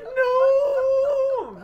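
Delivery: high, loud, panicked shriek; mock terrified scream. Crystal Maiden dragged into a team fight, shrieking high and loud. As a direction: scream like you're being dragged to your death. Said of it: "And she'd be like".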